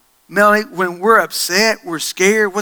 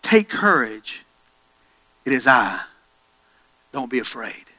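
tender reassurance; 6 semitones lower, 4 dB quieter than usual. Acted. Repeats Jesus' words softly.

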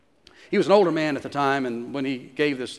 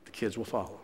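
fading echo; 5 semitones lower, 11 dB quieter than usual. Real, not acted. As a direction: let the repeat fall away to almost nothing.

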